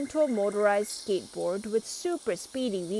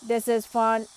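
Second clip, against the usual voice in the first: loud robot voice; 7 dB louder than usual. A narrator's reading. Robot over the noise.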